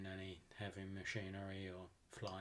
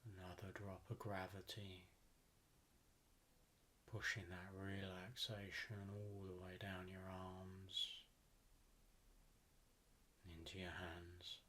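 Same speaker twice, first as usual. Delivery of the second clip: guided meditation; 8 dB quieter than usual. Acted. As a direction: ease them down into relaxation, unhurried and even.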